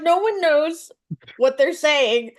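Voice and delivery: ordinary delivery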